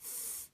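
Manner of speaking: very soft unvoiced "Th", tongue out; "Th" as pure air over the tongue; voiceless th. Shown as a demonstration. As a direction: breathe "Th" with no motor. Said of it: "Say it again".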